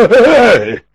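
loud high startled grunts; startled gasps. Acted. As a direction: gasp as the blow wakes you. Captives jolted awake.

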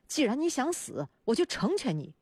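restrained mock roar, pitched well up. A narrator's reading. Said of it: "咆哮道". (提高声调、不放大音量地演出咆哮)

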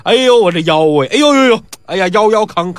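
moaning over his aching back; hammed-up groaning. Pi Wu moans at Jin about his back, hammed-up. Drunk Pi Wu fakes back pain.

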